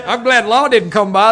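ordinary talk, nothing marked

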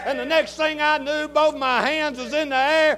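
full-force preaching. Acted. Preacher shouting testimony to congregation, full-force preaching.

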